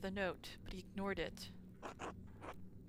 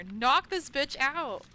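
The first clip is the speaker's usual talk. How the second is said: angry shout; 4 semitones higher, 8 dB louder, slower than usual. Real, not acted. Yelling at the game.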